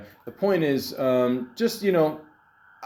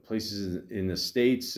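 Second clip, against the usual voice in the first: usual speaking voice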